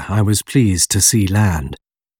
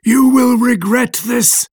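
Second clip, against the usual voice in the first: high, slow monster cry; creature crying out; 13 semitones higher, slower than usual. A narrator's reading. Creature threatens his creator as refused, high slow cry. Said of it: "cried the creature".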